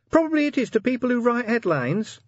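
very high-pitched, small sergeant voice; nervous sergeant. A narrator's reading. Sergeant answers the commander about the headline, high and nervous. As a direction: pipe up in a high little voice.

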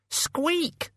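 very high questioning squeak; tiny shrill squeak; high rat squeak. Acted. Death of Rats squeaking after the sledge, very high.

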